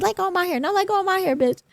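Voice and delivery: mock yelling man. Mimicking the guy's hair-pulling scream, high whiny mock yell. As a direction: ham up his yelling during the hair pull. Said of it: "He's like".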